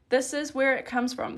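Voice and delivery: usual speaking voice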